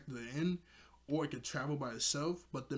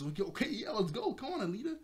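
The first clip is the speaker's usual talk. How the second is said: much higher-pitched mock urging; high, lightly hammed-up rallying; imitated eagerness; 9 semitones higher, faster than usual. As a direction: play someone eager to get going. Acts out Odd urging Alita.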